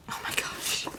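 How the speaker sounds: soft whispered astonishment; hushed awe. Real, not acted. Vlogger stunned by another sample, hushed awe. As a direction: whisper it, amazed.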